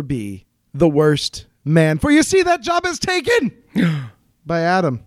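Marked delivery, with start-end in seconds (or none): 2.0-5.0 dramatic reveal. Acted. Dramatic reveal of worst man.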